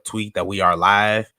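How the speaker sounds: ordinary talk, nothing marked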